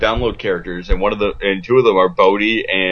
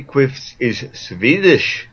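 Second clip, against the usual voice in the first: put-on slow, higher Swedish-accented voice; mock Swedish accent; 3 semitones higher, much slower than usual. Jokingly puts on a Swedish accent.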